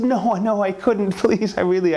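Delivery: high, hurried protest; mildly acted high-pitched demurral; flustered polite refusal. Maya politely refuses the necklace.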